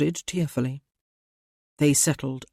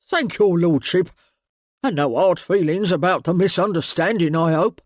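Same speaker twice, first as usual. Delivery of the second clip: pleased ratcatcher; 5 dB louder than usual. A narrator's reading. Ratcatcher thanks lord.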